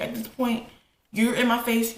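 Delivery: usual speaking voice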